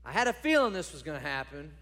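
loud, high whine at God; a sulky prophet griping at God; exasperated complaint. Acted. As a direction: throw the complaint right back at him. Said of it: "Jonah is like".

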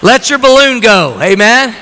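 high, loud exhortation; rousing preaching. Real, not acted. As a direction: urge the congregation to let loose. Preaching at full force.

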